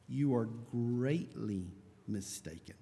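grave rebuke. Acted. Preacher quoting Jesus correcting the Sadducees, gravely. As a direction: draw out the rebuke solemnly. Quoted rebuke answering "minor mistake?.

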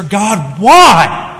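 a loud, high cry of why; anguished cry. Acted. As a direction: throw the why up to God. Voicing psalmist's question.

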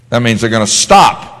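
normal talking voice

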